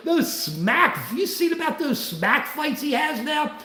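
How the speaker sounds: ordinary talk, nothing marked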